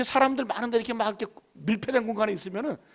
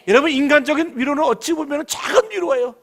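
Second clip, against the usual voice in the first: preaching; 6 semitones higher, 5 dB louder than usual. Acted. Preacher stresses small comfort.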